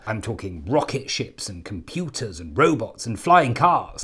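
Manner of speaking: ordinary talk, nothing marked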